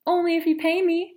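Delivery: high, loud repeat of only if you pay me. Acted. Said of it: "Mom asked what?".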